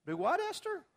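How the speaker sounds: high, disbelieving squeak; incredulous. Pretend. Teacher mock-questions Esther's odd choice, high and incredulous. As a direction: act baffled by her choice.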